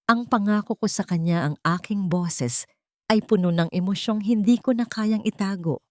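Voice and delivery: ordinary delivery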